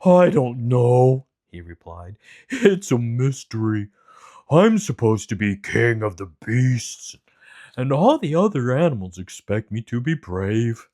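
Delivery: a shy king of the beasts; cowardly lion. Acted. Lion character voice.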